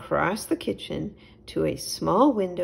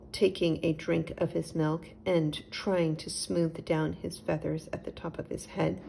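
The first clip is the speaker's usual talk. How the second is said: ordinary delivery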